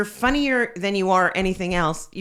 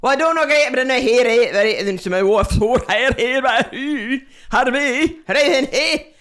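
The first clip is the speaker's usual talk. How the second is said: loud, high, thick Scottish mumble; Scottish husband voice; 5 semitones higher, 8 dB louder than usual. Retold or imitated. Comic imitating the giant Scottish husband, loud and high. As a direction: boom it out like a big Scot. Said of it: "giant Scottish husband came over and said".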